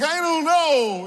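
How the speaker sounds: high, hammed-up tearful answer; playfully overdone helpless whine; mock tearful. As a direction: imitate yourself upset, pitch lifted. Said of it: "And I just start crying".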